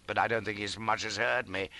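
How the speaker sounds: usual speaking voice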